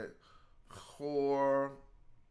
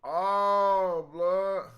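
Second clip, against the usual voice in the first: excited outburst; 5 semitones higher, 7 dB louder than usual. Real, not acted. Shocked by what he sees.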